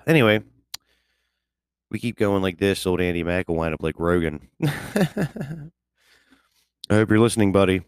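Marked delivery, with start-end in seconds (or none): 4.6-5.7 high laugh. Real, not acted. After joke about Rogan.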